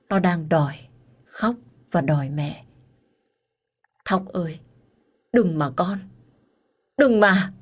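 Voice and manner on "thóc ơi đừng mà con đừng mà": broken plea building to a cry; anguished plea. Acted. Mother calling her child in a nightmare, anguished.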